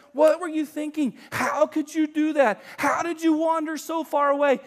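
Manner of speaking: high, loud accusing questions; mock scolding. Preacher mimics the rebuke Jesus never gives, high and loud. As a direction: pile on the blame. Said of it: "He never says".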